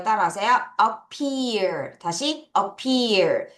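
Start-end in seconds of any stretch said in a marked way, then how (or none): none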